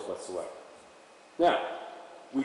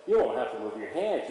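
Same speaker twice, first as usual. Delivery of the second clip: usual speaking voice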